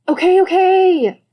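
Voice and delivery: loud, drawn-out giving in; exasperated surrender. Acted.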